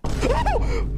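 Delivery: very high, loud shriek of fright; real jump-scare yelp; startled scream. Real, not acted. Player jump-scared in the game, shrieking.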